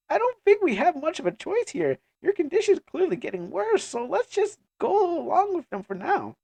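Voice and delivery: high-pitched, pleading character voice; worried companion. Acted. Companion persuading weakened Ayer, high and concerned. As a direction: pitch it high, urging gently.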